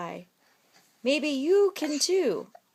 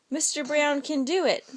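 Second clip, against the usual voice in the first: plain reading voice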